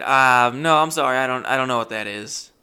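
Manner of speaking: embarrassed loud decline; flustered refusal. Retold or imitated. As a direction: turn her down, loud and awkward. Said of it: "and I said".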